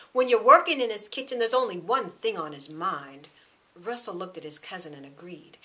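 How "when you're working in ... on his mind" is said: louder, higher, hard-edged warning; scowling warning. Acted. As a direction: lean in and warn her harshly. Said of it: "Thomas scowled".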